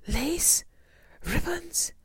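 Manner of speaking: loud, high, rising disbelief; incredulous shock. Acted. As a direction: exclaim each word as an appalled question. Shock at trimmed meat.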